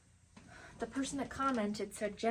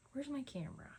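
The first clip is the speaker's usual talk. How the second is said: normal talking voice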